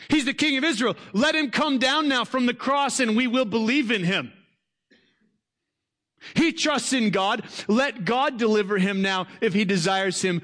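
high, jeering voice of the scoffing priests; sarcastic mockery. Preacher voicing the chief priests mocking Jesus, high and jeering. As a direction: sneer it up at the man on the cross. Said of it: "Do you hear just the sarcasm".

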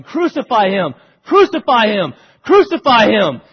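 a frenzied mob building to a roar; mob yelling. Retold or imitated. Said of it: "they are yelling".

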